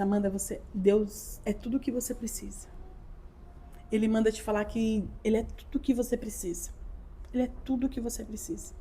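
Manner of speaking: soft, hushed message from God; solemn reassurance. Retold or imitated. Relays God's message to her. (recado de Deus, baixinho e acolhedor)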